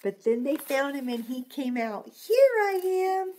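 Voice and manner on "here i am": slow cheerful reveal. Acted. Dinosaur reveal retold.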